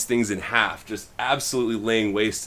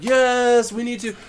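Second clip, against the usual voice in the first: high insistent reply; 12 semitones higher than usual. Retold or imitated. Rowan insists on using power.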